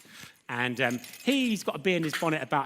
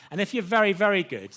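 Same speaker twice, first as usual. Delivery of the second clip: louder and higher, stressing "very"; emphatic; 5 semitones higher, 6 dB louder than usual. Acted. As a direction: push "very good" up and out. Louder first take of very good.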